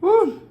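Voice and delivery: sharp high "woo" of relief; high loud whoop. Real, not acted. Reacting after eating hot food.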